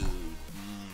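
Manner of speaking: usual speaking voice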